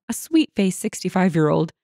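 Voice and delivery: ordinary delivery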